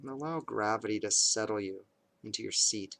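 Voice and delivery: calm, measured meditation voice with long pauses; guided meditation. Acted. Parent leading kids through bedtime meditation, calm and measured. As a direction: guide them unhurriedly into awareness of the breath, leaving space between phrases.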